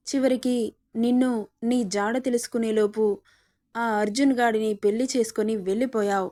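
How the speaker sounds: ordinary delivery, nothing marked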